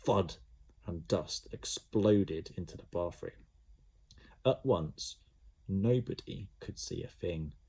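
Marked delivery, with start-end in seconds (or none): none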